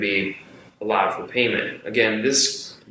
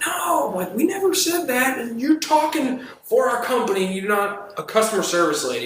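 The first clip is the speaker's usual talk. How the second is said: very high, exasperated correction; re-enacted indignant rebuttal; 12 semitones higher than usual. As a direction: put her in her place, voice pitched way up. Re-enacts angry rebuttal to coworker.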